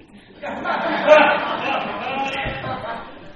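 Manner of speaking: big real laugh, high and loud; burst of laughter. Real, not acted. Laughing at retold outburst.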